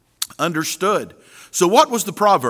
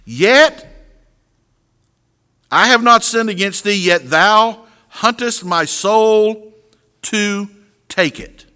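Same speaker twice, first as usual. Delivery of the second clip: slow, raised reading of the verse; scripture reading; 4 semitones higher than usual. Acted. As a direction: lift the verse up slowly, solemnly. Reads scripture aloud.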